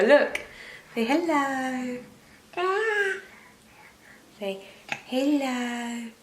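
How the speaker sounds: high, slow, sing-song coaxing. Real, not acted. Mum coaxing her baby to say hello, high sing-song.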